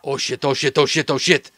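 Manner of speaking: rapid repeated cursing; urgent, run-together swearing; panicked muttering. Acted. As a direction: rattle it off in rising panic. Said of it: "He goes".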